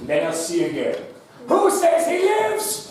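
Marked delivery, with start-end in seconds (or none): 1.5-2.9 arena emperor. Acted. Host asks the audience to spare the player, in a grand voice. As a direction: call to the mob like a Roman emperor.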